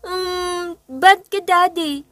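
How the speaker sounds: put-on high, loud bawling child voice; child wailing. A narrator's reading. Little girl bawling at teasing dad, high crying complaint. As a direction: cry it out like a hurt little kid. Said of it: "pumalahaw ng iyak".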